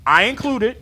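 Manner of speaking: ordinary talk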